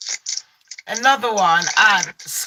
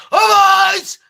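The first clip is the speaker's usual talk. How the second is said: a hammed-up carer bellowing, higher; mock rude shout; 10 semitones higher, 7 dB louder than usual. Imitating a rude carer barging in on a sleeping resident, mock shout. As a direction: blast it out like a careless carer at dawn.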